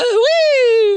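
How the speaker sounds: high, loud, joyful whee; gleeful whee. Acted. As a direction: squeal it with delight. Gleeful cry in story.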